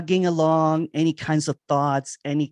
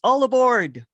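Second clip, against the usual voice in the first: screamed train call; 8 semitones higher, 6 dB louder than usual. Acted. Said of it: "It blows its horns and screams".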